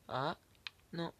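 ordinary talk

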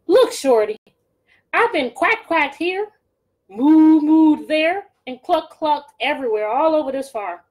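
slow, loud, drawn-out complaint; a weary farmer listing his chores; fed-up farmer. Acted. Farmer grumbling to Gritch about his work.

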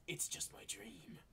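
slow breathy whisper of a character's line; hushed dramatic whisper; whispered quote. Acted. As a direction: whisper it slowly, as an acted line. Quoting comic line in whisper.

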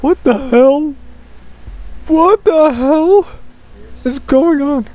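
high, bewildered exclamation; surprised. Real, not acted. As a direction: react in shocked disbelief. Reacting to friend's odd voice.

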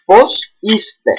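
"Πώς είστε" said as "pos ISte", teacher demo; Πώς είστε, σαν δασκάλα; Greek phrase spoken. Shown as a demonstration. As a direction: model "Πώς είστε" for students, stress on ί. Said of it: "we will ask him in plural".

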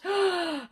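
sharp high gasp of delight; excited gasp. Real, not acted. Woman seeing the doll's legs, sharp gasp. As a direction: gasp in real wonder. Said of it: "all the gasping".

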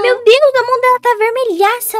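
high, shouted fake horror; mock panic. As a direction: yell in hammed-up alarm to mom. Pânico fingido com queimadura falsa. (grito de pânico fingido, bem agudo)

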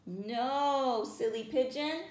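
plain reading voice

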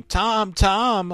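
high, loud mock scream of a name; imitated screaming. Dying friend screaming in the hospital, imitated scream. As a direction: cry out in retold panic. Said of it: "He was just screaming".

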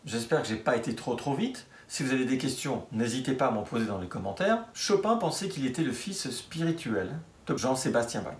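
ordinary talk

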